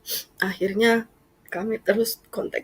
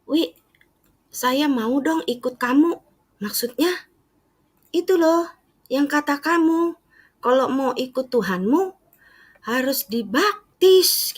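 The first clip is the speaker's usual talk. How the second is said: higher, louder imitated mother asking; 8 semitones higher, 3 dB louder than usual. Mother asks daughter about baptism, high and earnest. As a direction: earnestly beg to be baptised like mom. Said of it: "dari mulutnya sendiri ngomong".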